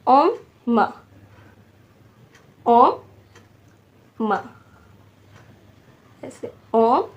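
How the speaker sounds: ओम and मा dictated with long gaps; syllable by syllable. Shown as a demonstration. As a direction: hold ओम, pause, then a short मा. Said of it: "लिखते हैं".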